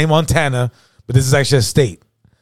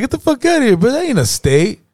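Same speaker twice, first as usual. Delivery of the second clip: very high-pitched mock disbelief; incredulous; 11 semitones higher than usual. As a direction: act out a friend flatly refusing to believe it. Friend's escalated acted disbelief.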